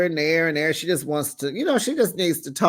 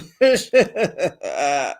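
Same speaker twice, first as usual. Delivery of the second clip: laugh; 3 dB louder than usual. Real, not acted. Host laughs at own recap.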